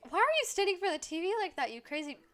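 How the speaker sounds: loud, high-pitched telling-off; exasperated scolding. Pretend. Streamer scolds a game character standing at the TV, loud and exasperated. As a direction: holler at someone blocking the screen.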